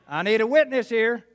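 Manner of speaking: calling out. Real, not acted. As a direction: call for a witness loudly. Preacher calls on congregation.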